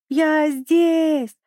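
high, drawn-out little penguin cry; shouting. A narrator's reading. Little penguin cries out to grandfather on shore, shouting. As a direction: cry out to be seen from afar. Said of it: "закричал Пик изо всех сил".

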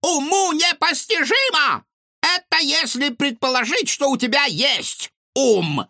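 high, loud retorting granny; cranky old woman. A narrator's reading. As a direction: snap back like a crabby neighbor. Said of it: "Не осталось в долгу соседка".

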